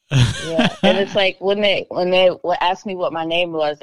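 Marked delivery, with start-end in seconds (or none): none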